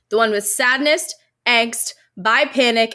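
normal talking voice

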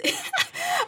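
short high nervous laugh. Real, not acted. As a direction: laugh it off awkwardly. Laughs before an unhappy reading.

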